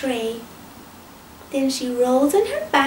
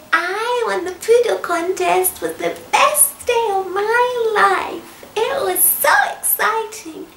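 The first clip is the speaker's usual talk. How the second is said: lightly voiced poodle; 5 semitones higher than usual. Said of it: "she said".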